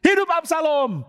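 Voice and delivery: teriakan tinggi lantang, pelan; seruan lantang. Acted. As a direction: serukan sambil mengangkat nama raja baru. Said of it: "dia langsung teriak". (Husai berseru lantang kepada Absalom: hidup Absalom)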